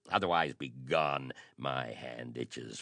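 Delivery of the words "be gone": usual speaking voice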